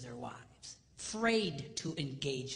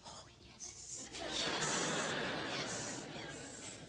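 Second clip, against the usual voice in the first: swooning whisper; 4 dB quieter, much slower than usual. Retold or imitated. Girlfriends swoon at the scene.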